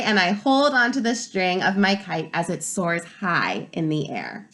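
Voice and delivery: ordinary delivery, nothing marked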